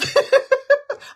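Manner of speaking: squealing high burst of laughter; real giggling fit about an aunt named Lola. Real, not acted. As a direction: crack up loudly. Laughing at her own joke.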